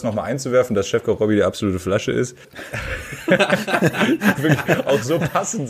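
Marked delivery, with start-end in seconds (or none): 3.3-5.7 laughing. Real, not acted.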